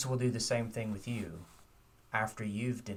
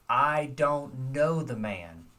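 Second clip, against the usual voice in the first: loud, slow, angry denial, pitched up; cursing denial; 4 semitones higher, 7 dB louder, slower than usual. Retold or imitated. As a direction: swear it out hard and slow. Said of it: "Peter curses and swears an oath".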